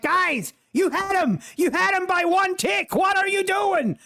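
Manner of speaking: imitating an outraged man, very high-pitched; mock furious yelling. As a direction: yell it in high, exasperated disbelief, hammed up. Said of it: "He is fighting mad".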